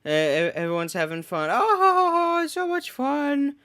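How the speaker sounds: high squeaky imitation of partygoers; squeaky cartoon voice. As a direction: chirp it like a cartoon crowd. Imitating cheerful characters.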